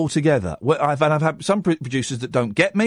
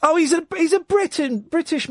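surprised; 12 semitones higher than usual. Real, not acted. Host surprised the American caller sounds British, high surprised voice. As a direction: pitch jumps way up in amused surprise.

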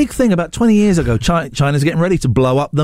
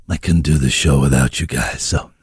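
very low, deep sign-off voice; mock late-night radio DJ; 12 semitones lower than usual. Signs off in mock DJ voice.

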